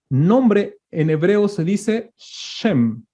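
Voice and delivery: very slow, each word spaced for note-taking; slow teacher dictation of a definition. Shown as a demonstration. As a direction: spell it out slowly so they can write.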